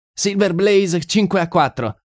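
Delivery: high-pitched bookmaker calling odds; bookmaker's cry. A narrator's reading. Bookmaker calls racing odds to the crowd, high-pitched cry. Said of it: "tuonò il bookmaker".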